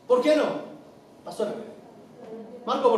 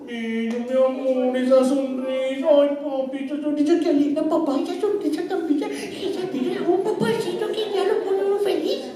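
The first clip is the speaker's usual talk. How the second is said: put-on doting baby talk. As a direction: melt over the baby's smile. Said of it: "Esa es mi forma de hablarle a Isaac". (padre encantado con la sonrisa de su hijo, voz mimosa)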